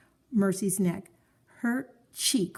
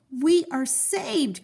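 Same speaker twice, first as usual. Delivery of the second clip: relieved cry; 8 semitones higher, much slower than usual. A narrator's reading. Missus Watson greeting the firemen from the hanging bed, relieved. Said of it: "cried Missus Watson".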